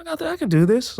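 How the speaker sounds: high-pitched mock confidence; self pep talk. Nervous actor reassuring himself over four lines, high mock confidence.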